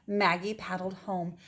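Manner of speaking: usual speaking voice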